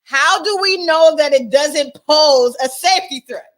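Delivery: loud, incredulous challenge, rising high; loud disbelief. Real, not acted. Reacting to the news clip about the balloon, loud disbelief. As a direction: demand it in outraged disbelief.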